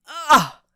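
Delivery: loud high scream of pain. A narrator's reading. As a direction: cry out from a broken nose. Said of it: "screamed the man".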